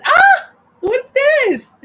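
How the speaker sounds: loud, very high-pitched yelp; shrieky, high exclamation; startled. Real, not acted. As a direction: squeal it in sudden surprise. Sudden startled exclamation while reading.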